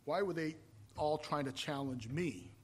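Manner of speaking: ordinary talk, nothing marked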